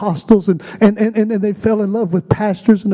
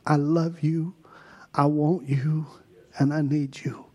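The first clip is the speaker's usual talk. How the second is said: much softer, slow, near whisper; whispered prayer; 3 semitones lower, 6 dB quieter than usual. Acted. Worship leader whispering love to Jesus. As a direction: let it fade into a breath.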